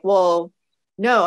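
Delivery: normal talking voice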